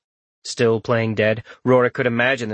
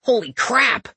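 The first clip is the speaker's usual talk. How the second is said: high amazed exclamation; surprised; 13 semitones higher than usual. Acted. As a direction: blurt it out in shock. Dark elf exclaims.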